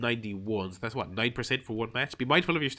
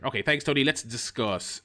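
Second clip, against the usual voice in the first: usual speaking voice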